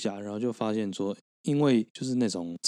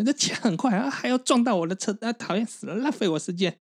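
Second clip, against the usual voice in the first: put-on high shrill whiny old grandma; shrill palace servant; 10 semitones higher than usual. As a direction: squeal it like a fussy old eunuch. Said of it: "你为什么要学一个公公？".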